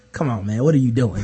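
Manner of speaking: low, quiet retold protest; mild exasperation. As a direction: voice a teammate's subdued complaint. Retelling teammate's quiet objection to foul.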